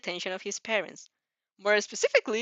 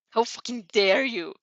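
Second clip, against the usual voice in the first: angry outburst, louder; furious cry; 7 dB louder than usual. A narrator's reading. Bully furious after being bitten. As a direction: spit it out in outrage. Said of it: "he cried out".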